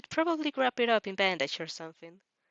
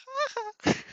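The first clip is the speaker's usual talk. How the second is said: high squealing laugh. Real, not acted. Reader watching the boy walk into a pole, high squealing laugh. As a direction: laugh up high, almost crying.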